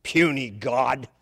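slow, low monster mutter; low, drawn-out dismissal; dismissive Hulk. Acted. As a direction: toss it off low and slow, like a brute walking away. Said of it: "Hulk just turned around and walked away".

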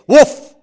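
loud, high imitated woof; sudden high-pitched woof; interrupting dog bark. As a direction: bark sharply, cutting in. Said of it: "interrupts the dog".

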